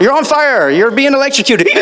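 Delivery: high, loud rapid-fire cues; imitated escalating direction; director calling out. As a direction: shout each disaster as a direction. Director calling out goblin actions.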